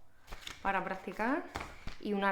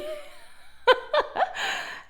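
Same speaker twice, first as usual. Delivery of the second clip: a high, airy burst of laughter; breathy real laugh; 5 dB louder than usual. Real, not acted. Laughing at the kiss mark on her book. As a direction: let the laugh slip out.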